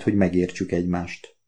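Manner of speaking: usual speaking voice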